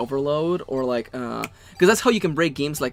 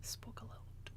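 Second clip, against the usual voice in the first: whispering; 27 dB quieter than usual. Real, not acted. Aside while reading the novel.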